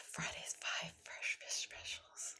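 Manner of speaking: soft whispered tongue twister. Shown as a demonstration. Coach whispering the tongue twister. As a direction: breathe it out as a whisper. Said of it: "here's another one".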